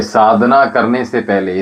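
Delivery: ordinary talk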